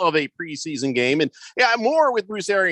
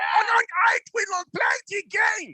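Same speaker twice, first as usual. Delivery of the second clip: higher, shouty mock German accent; 5 semitones higher than usual. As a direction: bark it like a hammed-up German general. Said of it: "do Tom Brady with a German accent".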